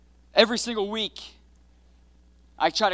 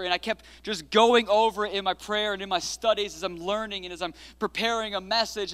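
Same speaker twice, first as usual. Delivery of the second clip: usual speaking voice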